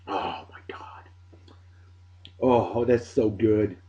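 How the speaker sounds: breathless hushed whisper, slow; awed whisper. Real, not acted. After the first sip, whispering slow in real delight. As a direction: sigh out pure pleasure under the breath.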